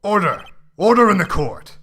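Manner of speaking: loud, stern gavel call; calling for order. Acted. Judge silencing a noisy courtroom, loud stern call. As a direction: bellow it to silence the room.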